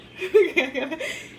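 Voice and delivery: loud, high laugh. Real, not acted. Laughing at her own purchase.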